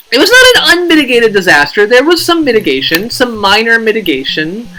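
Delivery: high, loud radio-host bluster; radio shock jock. Real, not acted. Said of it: "you sound like an A M radio shock jock".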